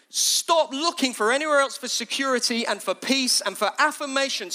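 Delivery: high, urgent plea; pressing, begging appeal; pleading. Retold or imitated. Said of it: "he's pleading".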